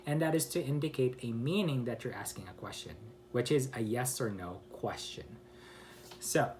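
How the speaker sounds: normal talking voice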